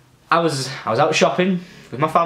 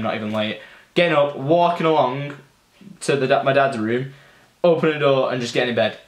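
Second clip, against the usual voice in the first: usual speaking voice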